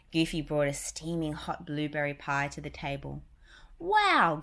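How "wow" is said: high, louder cartoon mouse girl; Minnie voice. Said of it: "said Minnie".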